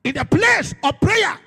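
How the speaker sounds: loud, rising sermon delivery with hammered phrases; preaching at full force. Acted. Pastor urging the church to pray, preaching loudly. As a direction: drive it home to the congregation.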